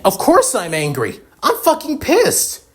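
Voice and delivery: high, loud, furious retort; angry outburst. Acted. Grieving mother retorts in acted quarrel.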